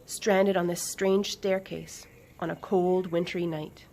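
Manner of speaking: ordinary delivery, nothing marked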